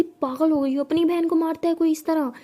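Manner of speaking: loud, high reprimand; mother breaks up the fight; scolding mother. A narrator's reading. (मां की डांट, तेज़ आवाज़ में)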